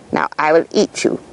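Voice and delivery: whispered, then low wolf threat; a hungry wolf savoring the threat; menacing wolf. Acted. Wolf tells boy he'll eat him, low menacing whisper.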